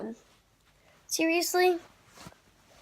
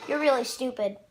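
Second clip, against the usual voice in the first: ordinary delivery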